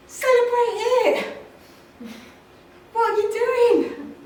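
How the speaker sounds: high, loud burst; rallying cry to celebrate; loud urging. Real, not acted. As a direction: shout it at the audience.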